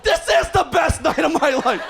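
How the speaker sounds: ecstatic. Retold or imitated. Comic in the glass cube to the crowd, ecstatic laughing shout. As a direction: crow it to the cheering crowd.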